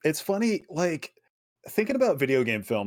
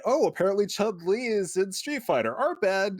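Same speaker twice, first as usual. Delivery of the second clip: mock apology; 11 semitones higher than usual. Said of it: "article at the end saying".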